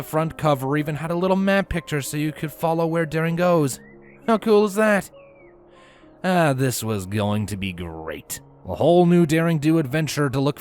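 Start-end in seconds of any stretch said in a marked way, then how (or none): none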